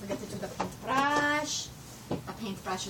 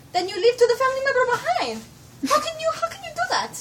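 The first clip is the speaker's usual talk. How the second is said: excited; 14 semitones higher, 9 dB louder, faster than usual. Real, not acted. Excited comic plea to viewers.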